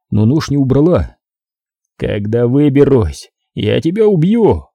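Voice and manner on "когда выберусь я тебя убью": ordinary delivery, nothing marked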